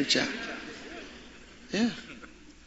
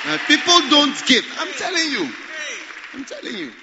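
loud, high-pitched preacher's shout; emphatic insistence; 11 semitones higher, 5 dB louder than usual. Real, not acted. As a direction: drive it home to the congregation.

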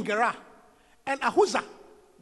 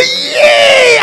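mock wail; 14 semitones higher, 14 dB louder than usual. Rejecter wailing in regret.

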